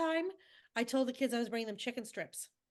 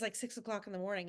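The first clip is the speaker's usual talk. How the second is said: normal talking voice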